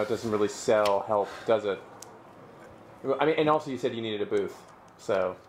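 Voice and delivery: ordinary delivery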